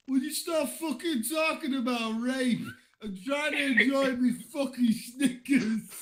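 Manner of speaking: put-on high-pitched, irritated British accent. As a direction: put on an indignant Brit, pitched well up. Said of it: "Why is it British?".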